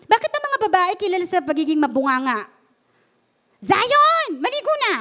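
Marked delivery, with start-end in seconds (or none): 3.6-4.9 put-on shrill-free loud call, pitched up, to a kid; calling out to Zion to take a bath, loud nagging mother; nagging mother yell. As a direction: yell at your son to go bathe like a nagging mom. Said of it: "Ano ba sa Tagalog ng nagger? Bungangera".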